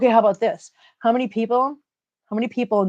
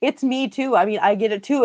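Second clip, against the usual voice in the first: usual speaking voice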